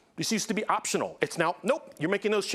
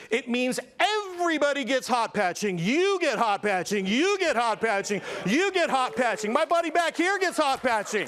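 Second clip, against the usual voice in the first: rising hammed-up hype, pitch climbing higher each line; building excitement, higher on each You get hot patching; game show giveaway; 6 semitones higher than usual. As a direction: hand out prizes like a talk show host.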